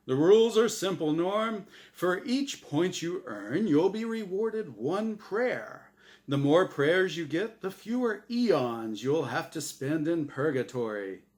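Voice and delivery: booming, bright host voice; game show host. Acted. The angel Azrael hosting his show for Norm, big host voice. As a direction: sell it like a TV quiz host.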